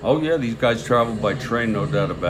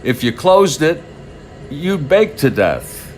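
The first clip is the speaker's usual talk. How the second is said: put-on louder, higher old-interview quote; lightly performed, emphatic recollection of a ballplayer; Smokey Joe Wood; 4 semitones higher, 4 dB louder than usual. Retelling a train-ride interview to listeners, emphatic quoted voice. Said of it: "in his sort of southern accent, I can't do it".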